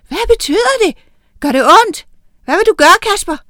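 very high, loud, clamouring questions; excited children's questions. A narrator's reading. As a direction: fire off questions like a crowd of children. Children's questions fly from all sides.

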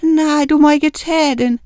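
high, tearful protest; crying plea. A narrator's reading. Miranda begs the rat not to take Oline, crying. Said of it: "græd Miranda".

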